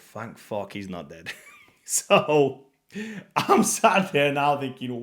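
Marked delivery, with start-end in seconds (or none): 0.0-1.3 soft, slow relieved murmur; relieved thought. Retold or imitated. Narrator thinks to himself after the scare, soft relief. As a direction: breathe it out in relief.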